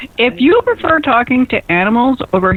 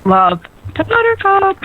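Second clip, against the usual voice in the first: much higher, playful repeat of the phrase; high sing-song echo; 9 semitones higher than usual. Pretend. Echoing her co-host in a high playful voice. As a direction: chirp it back in a high playful voice.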